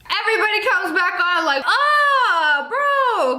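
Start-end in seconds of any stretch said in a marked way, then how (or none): 1.7-3.4 high, drawn-out mock wail; mock outcry. Commentator mimics everyone coming back on Zoom, mock outcry. Acting out reaction to everybody returning.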